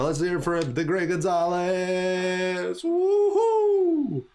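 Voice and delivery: long drawn-out name rising into a high whoop; announcer's victory cheer. Acted. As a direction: rouse the crowd for the champion. Announcer cheering the winner to crowd.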